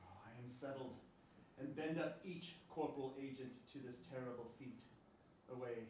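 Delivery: low, soft, settled voice; quiet resolve. Acted. Husband committing himself to the murder, quiet resolve.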